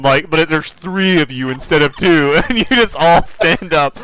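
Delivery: ordinary talk, nothing marked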